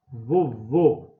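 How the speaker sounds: "Vovô" slow and loud, leaning on the final VÔ; teacher's emphatic demo of "Vovô", stressed on the end; stressed last syllable. Shown as a demonstration. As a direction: stretch "Vovô" into vo-VÔ. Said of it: "é o vô".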